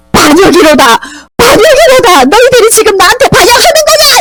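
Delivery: high-pitched cry of treason; outraged shouting. Acted. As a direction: wail it out as a furious queen. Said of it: "이렇게 고함을 질렀지만".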